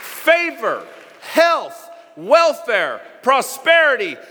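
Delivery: high, ringing preacher's climax; preaching at full force. Real, not acted. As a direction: build it up, preaching with full fire. Preaching climax, rising fervor.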